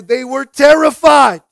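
loud, high, drawn-out emphasis; slow it right down and punch the fear; dramatic preaching. Real, not acted. Preacher dramatizes the verse climax.

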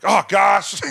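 dramatic exclamation. Retold or imitated. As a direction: overplay Jimmy's fake panic. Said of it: "it was very dramatic".

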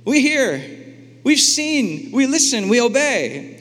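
high, eager voice of the people answering God; a high, eager crowd answering God; eager people's reply. Retold or imitated. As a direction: chirp it up high like keen new converts. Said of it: "the family responds".

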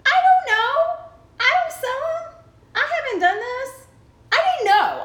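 squeaky high defensive denial; high, mock-offended protest. Real, not acted. Defensive denial, teased about panties.

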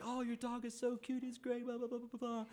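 high, soft cooing admirers; high-pitched gush running into babble; mock gushing. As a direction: imitate strangers fawning over a cute dog. Imitates everyone gushing over the dog.

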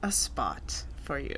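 low, slow whisper; whispered menace. Pretend. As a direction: whisper it like a veiled threat. Joking threat, whispered.

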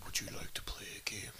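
soft, low put-on whisper; mock-sinister hushed invitation; menacing whisper. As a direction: whisper it like a creepy caller. Spoofing a menacing threat caller.

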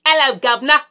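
loud hammed-up cockney greeting; British accent. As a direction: shout it like a stage Londoner. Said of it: "Just kidding".

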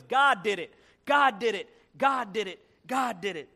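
mildly excited repeated exclamation; lightly acted joyful refrain; happy. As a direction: punch GOD each time, happily. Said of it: "she got happy".

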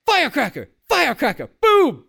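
high-pitched hollered impression of a yelling man; mock yelling. Co-host imitating the man caught screaming at night, mock yelling. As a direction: shout it out like a human firework. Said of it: "So he was yelling".

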